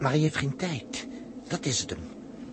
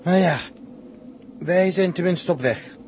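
loud, higher, relieved cheer; loud, higher, hopeful call; upbeat exclaim; 8 semitones higher, 6 dB louder than usual. Acted. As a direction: lift the spirits as they set off. Cheering up as convoy departs.

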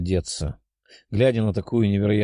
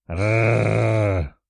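dragon growl; rolled beastly snarl; drake. Acted. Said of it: "прорычал дракон".

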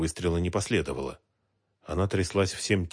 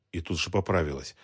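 plain reading voice

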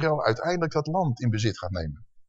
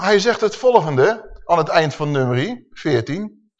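ordinary talk, nothing marked

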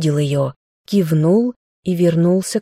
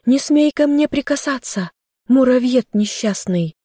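high indignant cry; angry outburst; 8 semitones higher than usual. Acted. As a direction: snap at him not to touch her. Girl fights off captor.

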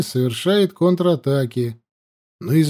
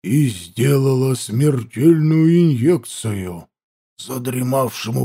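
sinister voice; 3 semitones lower than usual. A narrator's reading. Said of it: "зловещим голосом начал Данилов".

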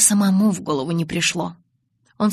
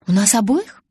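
firm, without hesitation; решительно; 4 semitones higher, 5 dB louder than usual. Acted. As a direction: say it with firm certainty. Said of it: "решительно и без колебаний заявила я". (решительно, без колебаний)

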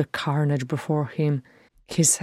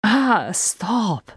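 higher-pitched exclamation; sharp protest; 6 semitones higher than usual. A narrator's reading. Thorin ordering an end to it, exclaimed protest. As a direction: burst out to cut them off.

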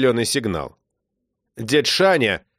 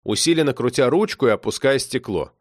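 ordinary delivery

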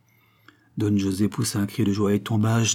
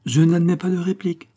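mock-bossy and playful, pitched higher and louder; playful, teasing; 9 semitones higher, 4 dB louder than usual. Princess playfully overrules her lover's objection, teasing. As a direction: tease him, cutting him off with a laugh in it. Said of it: "d'un petit ton mutin et rieur".

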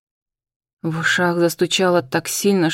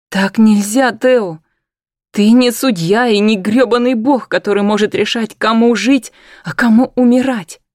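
angry shout; 4 semitones higher, 6 dB louder than usual. Acted. She jumps up, furious.

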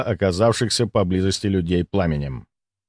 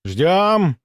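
higher, more urgent hold; firmer command; 6 semitones higher than usual. Acted. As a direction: press the order harder. Commander holding fire, louder.

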